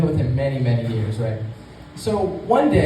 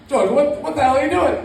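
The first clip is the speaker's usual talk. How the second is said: upset Dick Cheney; 9 semitones higher than usual. Retold or imitated. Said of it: "he said to George".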